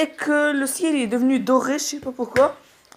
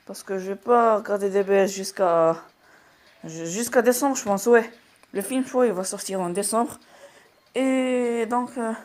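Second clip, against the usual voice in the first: ordinary talk, nothing marked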